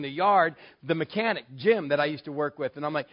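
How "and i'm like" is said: ordinary delivery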